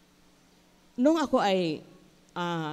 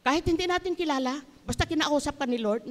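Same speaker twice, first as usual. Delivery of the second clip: normal talking voice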